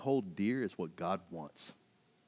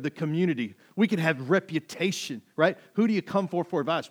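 loud, raised-pitch sermon delivery; forceful preaching; 7 semitones higher, 11 dB louder than usual. Real, not acted. Preacher warning church against empty religion, forceful. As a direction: drive the point home hard.

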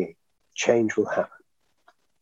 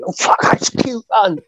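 laughing; 11 semitones higher, 4 dB louder than usual. Real, not acted. Quoting lines through laughter.

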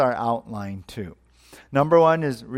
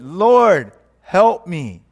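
loud, raised plea; acted cry for help; 5 semitones higher, 8 dB louder, much slower than usual. Hanging man in a joke begs the Lord, crying out. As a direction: call up to heaven for rescue.

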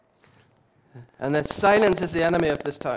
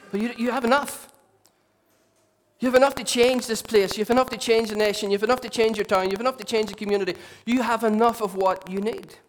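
higher, louder, building exhortation; rousing preaching; 5 semitones higher, 5 dB louder than usual. Acted. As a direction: fire up the congregation.

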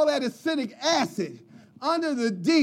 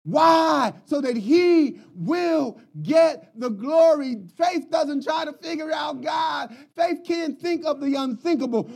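preaching at full force; 5 semitones higher than usual. Acted. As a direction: preach it to the congregation with fire. Preaching climax about faith.

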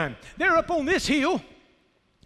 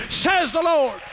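usual speaking voice

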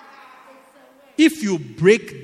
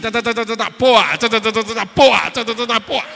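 loud rattling bursts, each ending in a blast; imitated gunfire; 4 semitones higher, 10 dB louder, faster than usual. Preacher acting out weapons always shooting, imitated gunfire. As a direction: fire off "ta-ta-ta" and explode on the last word.

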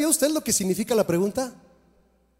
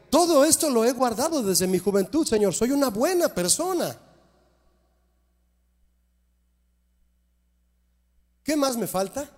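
higher, smug self-satisfied asking; a light touch of smug irony; ironic question; 5 semitones higher than usual. Acted. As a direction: let the irony drip from the question. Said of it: "La pregunta va con ironía".

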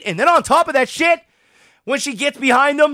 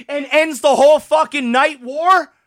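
usual speaking voice

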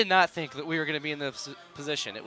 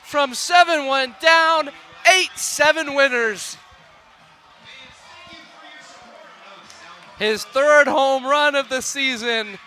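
raised, thrilled broadcast call; excited play-by-play; 12 semitones higher, 13 dB louder, slower than usual. Real, not acted. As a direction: ride the celebration for the listeners. Live play-by-play celebration.

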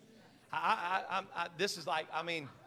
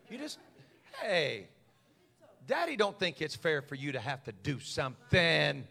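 mock doting dad; 4 dB quieter than usual. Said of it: "I was like".

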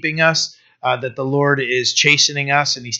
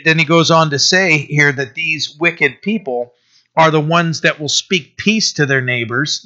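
ordinary delivery

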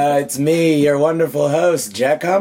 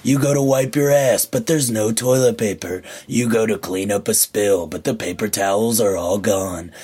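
ad read; 5 semitones lower than usual. Acted. Host opening the show with a mock ad, dramatic voiceover. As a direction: sell the setup like a TV spot.

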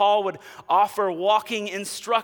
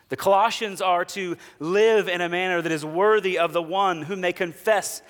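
ordinary delivery

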